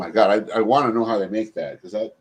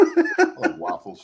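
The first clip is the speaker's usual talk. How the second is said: laughing. Real, not acted. Laughing reply about waffles.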